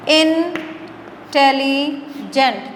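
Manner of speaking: "Intelligent" very slowly in three parts, in - telli - gent; syllable-by-syllable teaching demo of "intelligent"; split into syllables. Shown as a demonstration. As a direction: break "intelligent" into three drawn-out chunks with long pauses. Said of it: "तीन हिस्सों में हो गया".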